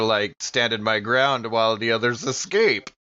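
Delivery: normal talking voice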